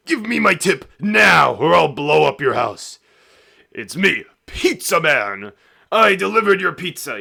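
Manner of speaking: high, full-force angry shouting; extremely aggressive. Acted. Pizza Man demands his tip from a customer, aggressive shout. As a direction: yell it as an unhinged, threatening delivery guy. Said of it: "extremely aggressive. He's like".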